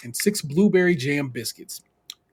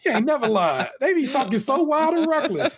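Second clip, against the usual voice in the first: mock agreeing; 9 semitones higher than usual. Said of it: "And I'm like".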